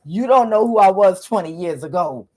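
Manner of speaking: indignant boast in a put-on African accent; African man's accent. Ex telling her off about his soccer past, mock African accent. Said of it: "imagine somebody saying this shit with an accent".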